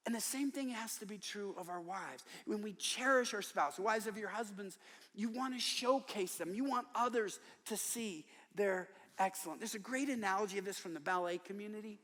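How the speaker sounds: ordinary talk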